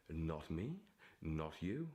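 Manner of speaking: normal talking voice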